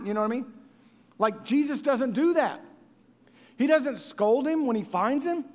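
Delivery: usual speaking voice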